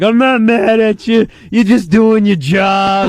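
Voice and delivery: put-on slow, drawn-out forgiving drunk; understanding drunk. Drunk driver excusing the arresting cop, exaggeratedly understanding. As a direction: imitate a drunk magnanimously excusing someone. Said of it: "You were the understanding guy".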